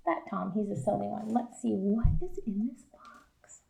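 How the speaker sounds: soft secret whisper. Real, not acted. Teacher whispering to the class about the box. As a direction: hush it like a secret.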